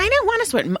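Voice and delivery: high-pitched imitation of a kid refusing; protesting kid. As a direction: put on a high voice of a balky son. Son refusing the sweater.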